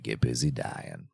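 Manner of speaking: a deep, slow imitation of Morgan Freeman. Shown as a demonstration. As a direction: drop the voice way down and drawl it out like a movie narrator. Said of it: "I can say it with a deep voice".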